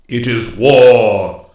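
very loud, slow, ringing pronouncement; booming declaration. A narrator's reading. Master woodsman declares war on the king, loud and commanding. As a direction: proclaim it slowly at full voice.

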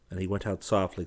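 plain reading voice

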